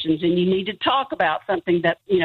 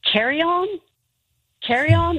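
higher, puzzled questioning try; sounding it out; 11 semitones higher than usual. Retold or imitated. Sounding out a new word to her brother, puzzled. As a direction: test an unknown word aloud, pitched up. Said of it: "I spelled it out loud".

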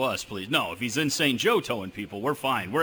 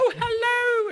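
very high-pitched mock-royal English greeting; a hammed-up regal falsetto hello; Queen of England; slower than usual. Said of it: "That's my Queen of England".

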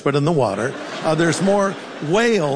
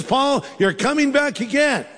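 higher-pitched, lightly acted Lord's announcement; playfully upbeat voice of the Lord; mock good-news announcement; 6 semitones higher than usual. Acts God announcing good news.

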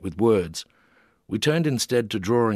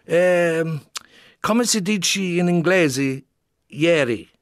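higher, louder, slow Italian question; laughing Italian question; 8 semitones higher, 4 dB louder, much slower than usual. A narrator's reading. Italian artist asking the English word, slow and high. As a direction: ask it playfully in Italian. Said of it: "inquired Gianni, laughing".

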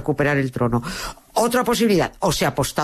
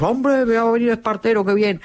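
higher-pitched voice of a pleased crowd; imitated delight, pitched up; mock relieved cheer; 5 semitones higher than usual. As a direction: voice delighted townsfolk welcoming good news.

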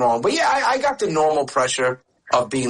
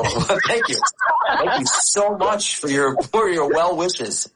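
higher, slow thanks through laughter; laughing sarcastic thanks; 6 semitones higher, slower than usual. Retold or imitated. The son thanking his mom for the wish, mock grateful while laughing.

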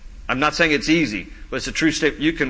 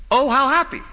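higher, lingering Oh, how happy, with joy in the happy; savoring the phrase; 8 semitones higher than usual. Shown as a demonstration. Pastor savoring the translation for the congregation, higher and joyful. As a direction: let happy ring out high and glad. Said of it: "it literally translates".

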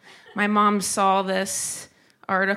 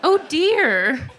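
higher, louder prim mother reading her comment; mock prim mother, higher and louder; mom's prim voice; 6 semitones higher, 3 dB louder than usual. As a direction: play the proper mom, scandalized but polite. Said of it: "And she wrote".

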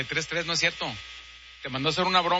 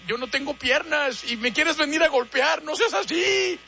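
higher, hammed-up pleading; mock fearful plea; 7 semitones higher than usual. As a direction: beg him not to hit you. Legless old man begs.

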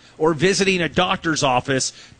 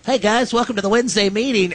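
higher, put-on announcer voice for a meeting; Jeff Jarnigan; 5 semitones higher than usual. Jeff opening the Wednesday meeting, mock announcer voice. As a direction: greet staff in a mock radio voice. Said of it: "really talks like this".

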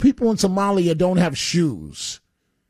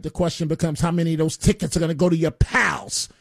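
normal talking voice

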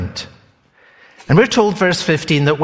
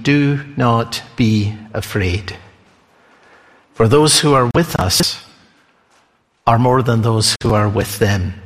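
calm reassurance; 6 semitones lower than usual. Acted. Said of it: "And Elisha said".

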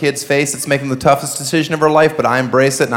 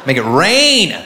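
normal talking voice

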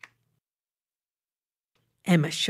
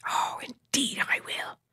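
gloating whisper; 7 semitones higher, 5 dB quieter than usual. Acted. Emma gloating quietly.

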